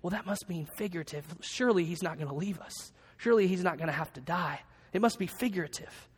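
disciple's worried aside. Retold or imitated. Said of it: "some other ones saying".

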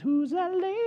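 high, far-off mock swoon voice; a hammed-up smitten king; playful David. David voiced eyeing Bathsheba.

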